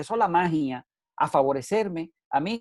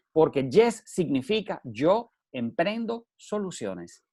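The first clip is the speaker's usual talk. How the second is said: "yes" stretched, each word slow and deliberate; slow emphatic; slower than usual. Shown as a demonstration. Speaker explaining the meaning of the acronym, slow. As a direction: spell out the acronym slowly. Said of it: "Ahí están las siglas".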